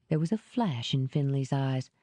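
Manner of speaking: usual speaking voice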